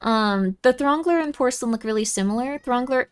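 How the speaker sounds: ordinary talk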